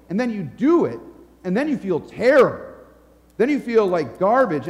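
ordinary talk, nothing marked

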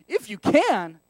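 sassy, mildly affronted retort. Acted. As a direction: throw it back with sass. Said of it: "he has sass".

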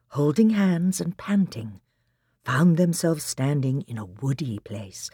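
ordinary delivery

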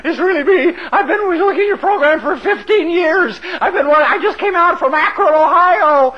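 high-pitched, giddy game show winner; breathless ecstatic contestant. Retold or imitated. Contestant reaching the stage on Price is Right, breathlessly ecstatic. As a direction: gush excitedly as if you cannot believe your luck. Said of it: "to arrive breathlessly".

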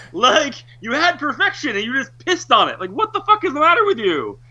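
exasperated rant. Retold or imitated. Fan scolding the filmmakers, high and exasperated.

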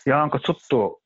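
put-on light Kansai accent, casual grumble; Kansai dialect. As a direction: toss it off in mild Kansai accent. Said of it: "関西弁で言ったりする".

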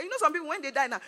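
normal talking voice

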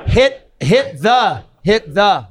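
high, drawn-out mimicked director's shout; mock director hammering a note, slow and high; Kevin. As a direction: imitate an insistent director calling from the monitor. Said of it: "Kevin would be like".

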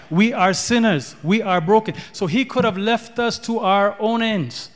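normal talking voice

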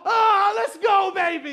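very loud, high, drawn-out whoop; acted-out celebration shout; triumphant yell. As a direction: roar it in victory. Acts out her victory cry.

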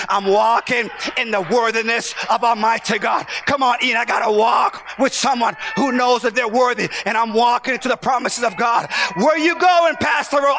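high, loud shouted near-singing; climactic preaching. Real, not acted. Preacher whooping his closing over the organ. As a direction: ride the music into the climax.

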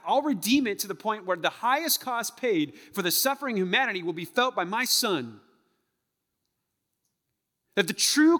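high, loud, impassioned sermon; full-force preaching. Real, not acted. Preacher to congregation on suffering redeemed, full-force preaching.

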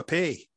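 "pay" with an AY vowel, standing for pie; Dundee pronunciation. Shown as a demonstration. Showing guest how Dundonians order a pie, "pay" with an AY vowel. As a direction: say "pay" like "day" where pie is meant. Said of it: "as in P A Y".

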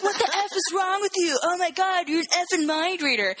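high-pitched indignant outburst; mock outrage, voice shooting way up; outraged retort. Slapped man rounds on the woman, high-pitched mock outrage.

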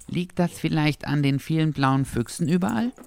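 high, timid dachshund voice; a little intimidated. A narrator's reading. Dachshund asking Henry about the many foxes, slightly intimidated. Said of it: "fragte Dackel ein wenig eingeschüchtert".

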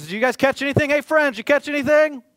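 high, loud call across the water; friendly stranger calling out loud; calling from shore. Acted. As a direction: shout out to the boat from the shore.

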